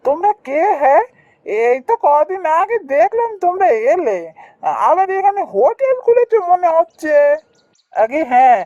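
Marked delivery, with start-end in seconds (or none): 0.0-7.1 high-pitched inquisitive villager sizing up newcomers; high, prying character voice; nosy local customer. Acted. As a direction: question the newcomers in a raised, nosy voice.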